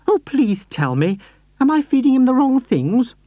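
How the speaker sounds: put-on high, sweet old lady voice; Mrs Silver voice. A narrator's reading. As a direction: lift into a gentle, doting lady.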